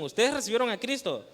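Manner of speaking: ¿ustedes recibieron a Cristo? pushed up and emphatic; with conviction. Shown as a demonstration. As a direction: ask it like a rallying cry. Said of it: "Díganlo con convicción".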